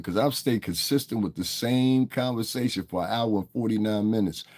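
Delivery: ordinary delivery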